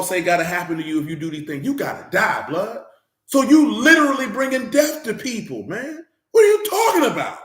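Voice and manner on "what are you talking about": high, loud disbelieving cry; nearly cracking outcry of disbelief; exasperated outcry. Real, not acted. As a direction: throw up your hands in disbelief. Exasperated outburst at preachers.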